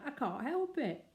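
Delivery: words breaking into laughter; bursting out laughing. Acted. Mister Brown to his wife after Curry storms out, laughing. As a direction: give in to the giggles. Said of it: "bursting out".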